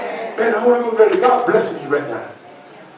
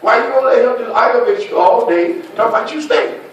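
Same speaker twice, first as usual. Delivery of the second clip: usual speaking voice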